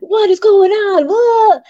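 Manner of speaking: high, shaky, hammed-up alarm; mock panic. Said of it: "I'm like".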